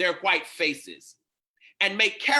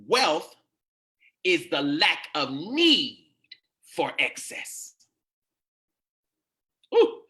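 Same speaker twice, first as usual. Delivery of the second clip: slow, emphatic preacher's proclamation; preaching a maxim; 2 semitones lower, slower than usual. Acted. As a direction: hammer out the maxim phrase by phrase, with weighty pauses.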